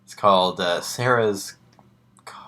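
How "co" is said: ordinary delivery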